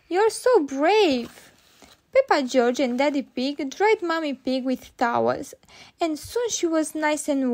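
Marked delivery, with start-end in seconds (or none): 0.1-1.5 slow, higher, louder praise; admiring child. A narrator's reading. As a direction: gush slowly like an impressed little girl. Peppa admires Mommy's rescue.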